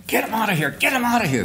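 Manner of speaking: high-pitched imitation of panicked screaming; retold screaming. Soldiers begging for the wounded man's removal, high imitated screams. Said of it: "everybody's screaming".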